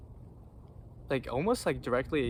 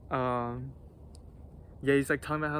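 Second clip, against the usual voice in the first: ordinary delivery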